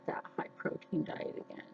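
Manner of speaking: fast, breathy aside under her breath; sudden whisper. Real, not acted. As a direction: drop to a quick whisper for "high protein diet". Repeating her diet plan, whispered.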